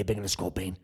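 usual speaking voice

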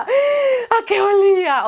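high, slow, eager fan question; excited fangirl question. Retold or imitated. Retelling the theater visit as an awed fan, high and slow. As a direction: draw it out like a starstruck fan. Fan asking about Brecht.

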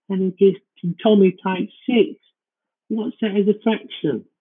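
put-on high whiny kid asking questions; squeaky excited child. Child asking dad maths question.

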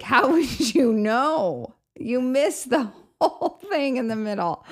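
high, drawn-out mock protest; mock exasperation. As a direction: imitate an incredulous objection, stretching the words. Retold teasing objection to movie verdict.

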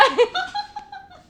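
helpless shrieking giggle; high squealing laugh. Real, not acted. Ending the story to her friends, squealing with laughter. Cracking up.

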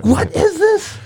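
high-pitched, acted astonishment; shocked disbelief. Retelling two friends' reaction as he wakes up, shocked disbelief. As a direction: exclaim it high, imitating total bafflement. Retold shock at man's sudden recovery.

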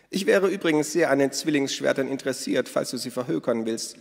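hohe, laute Stimme einer lebhaften Frau; Minnas Stimme. A narrator's reading. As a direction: Minna ruft die Gäste fröhlich zum Essen. Narrator voices Minna. (hohe, laute Frauenstimme)

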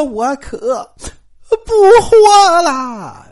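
high, loud, slowly drawn-out crying wail; wailing tantrum. Retold or imitated. Wife throwing a tantrum at her mother-in-law, wailing and crying. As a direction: wail it like a hysterical fit. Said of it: "这就撒泼打滚耍起无赖来了".

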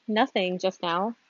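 usual speaking voice

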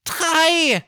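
hoch und laut gerufen; zähl laut mit hoher Fabelstimme; Barney zählt. Acted. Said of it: "Barney beginnt zu zählen". (Barney zählt laut hoch)

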